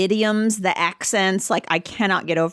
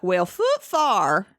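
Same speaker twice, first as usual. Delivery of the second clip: Appalachian exclamation; much slower than usual. Retold or imitated. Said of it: "you'd be like".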